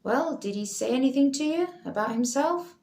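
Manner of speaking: a lightly voiced formal police constable; police officer. Officer questions Leela.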